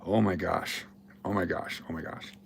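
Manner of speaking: soft, fading, exasperated oh my gosh; exasperated mutter. Real, not acted. Streamer sighing at literal viewers before his lecture, muttered. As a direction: sigh it out under your breath, fading.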